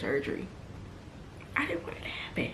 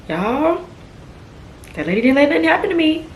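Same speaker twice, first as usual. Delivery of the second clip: emotional outburst; 8 semitones higher, 10 dB louder than usual. Real, not acted. Tearful praise of the nurse.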